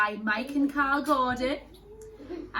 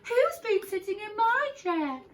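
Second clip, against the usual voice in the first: much higher, indignant mother bear; Mummy Bear; 12 semitones higher, 4 dB louder than usual. Acted. Mummy Bear finding her chair moved, high and indignant. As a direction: ask it in a high startled voice. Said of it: "said Mummy Bear".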